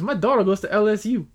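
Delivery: high, quick mock Southern protest; imitated Louisiana accent, indignant; Southern drawl. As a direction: mimic an older Southern man. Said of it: "he had a little bit of a lisp too".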